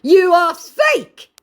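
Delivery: high, loud, slow accusation; mock scammer voice. Vlogger mocking the scammer's accusation, high and loud. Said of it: "he accuses her".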